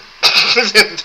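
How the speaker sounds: loud high laugh; short loud burst of laughter. Real, not acted. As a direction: laugh at your own motto. Laughing at own motto.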